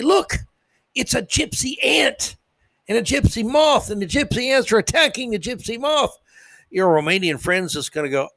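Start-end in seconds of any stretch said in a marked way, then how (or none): 0.0-6.2 high-pitched, hammed-up amazement; acted excitement. Insect fan showing a visiting Romanian friend, hammed-up excitement. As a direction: point out a thrilling discovery.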